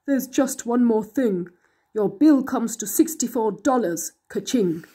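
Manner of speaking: a scheming monster voice selling a deal; sly monster. A narrator's reading. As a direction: tempt the kid like a smooth-talking monster. Monster presents the bill.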